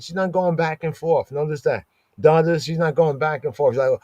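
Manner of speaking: ordinary delivery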